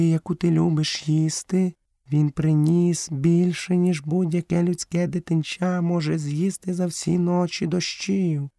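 high, measured feline voice; panther character, defensive. A narrator's reading. Panther defends Hathi and herself to Mowgli, high voice.

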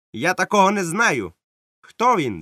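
high, loud curt retort; captain refusing to recognise Silver; dismissive captain. Acted. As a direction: brush him off sharply. Captain denies knowing him.

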